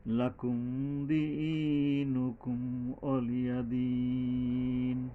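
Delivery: slow, drawn-out chanted recitation; tajweed chant; Quran recitation. Shown as a demonstration. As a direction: recite it melodically, stretching vowels.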